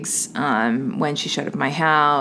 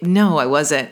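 ordinary talk

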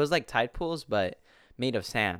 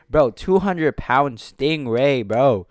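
loud, drawn-out, incredulous yell; higher, booming disbelief; emphatic yelling; 3 semitones higher, 11 dB louder, much slower than usual. Real, not acted. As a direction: yell it slowly, hammering every word. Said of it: "I'm sorry if I'm yelling".

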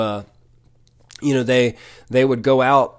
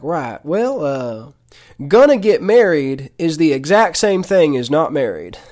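raised, emphatic Mississippi drawl; Keith Moore impression; 4 semitones higher, 5 dB louder than usual. Pastor setting the couple straight, in a Mississippi accent. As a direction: deliver a firm verdict in a Mississippi accent. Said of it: "I'm gonna try to talk like him".

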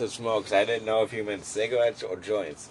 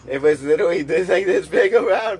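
high, loud, rushing amazement; excited; 9 semitones higher, 10 dB louder than usual. Real, not acted. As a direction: blurt it out in disbelief. Amazed retelling of giant bowl.

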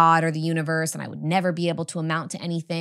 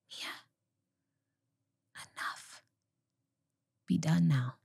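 very soft inner voice, long pauses; hushed, sparse imitation of a small whisper; 2 semitones higher, 7 dB quieter than usual. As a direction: whisper it gently, phrase by phrase. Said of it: "I just heard this small whisper".

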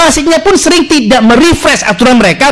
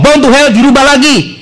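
normal talking voice

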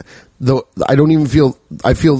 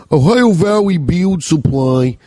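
announcer voice; 4 semitones higher, slower than usual. Acted. Sponsor name said in put-on voice.

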